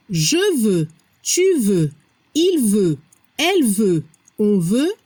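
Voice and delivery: low, even list with "veux" and "veut" identical; same-sounding verb forms. Shown as a demonstration. Teacher proving to learners all forms sound equal, even low list of "veux" and "veut". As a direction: run through "je veux" to "on veut", each verb sounding alike. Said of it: "todos suenan igual".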